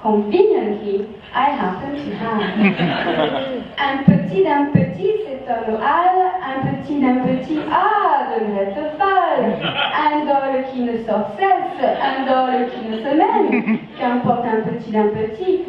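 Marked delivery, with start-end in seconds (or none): 3.8-15.6 strong French accent. Shown as a demonstration. Translator reads the rhyme to the audience in a strong French accent. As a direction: read the rhyme in a thick French accent, so it echoes Humpty Dumpty in English. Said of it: "If this is said with a strong French accent".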